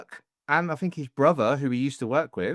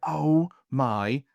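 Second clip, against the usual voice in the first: slow, drawn-out Scottish exclamation; Scottish accent; much slower than usual. Retold or imitated. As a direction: slip into Scottish, stretching every word. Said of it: "I keep doing Scottish".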